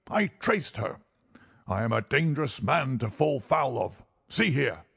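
put-on high-pitched, threatening old villain; angry old doctor. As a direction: threaten them in high, indignant fury.

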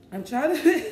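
high, strained and tearful; choking up mid-sentence, real; voice breaking. Real, not acted. Crying while trying to talk.

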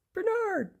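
very high squeaky single name; the smallest friend calling out; tiny creature. Acted. Tiny animal greets Bernard.

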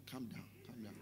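low, very soft voice; hushed calming. Acted. Somebody telling the shouting preacher to settle, hushed calming. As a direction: quietly talk the shouter down. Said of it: "somebody just tell me".

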